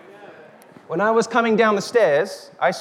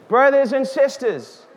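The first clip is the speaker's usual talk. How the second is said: ordinary delivery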